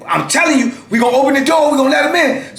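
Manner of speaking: high, emphatic retold threat; threatening officers. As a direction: imitate guards giving a warning, high-pitched. Officers threaten to release the dog.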